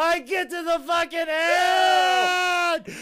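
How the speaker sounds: high, loud, drawn-out wail; hammed-up howl, stretching the last word; anguished mock scream. Wrestler retelling blowing the finish, wailing.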